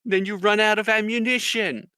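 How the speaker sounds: high, loud mock lecture; drill sergeant. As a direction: drive the obvious home to a recruit. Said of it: "he's like".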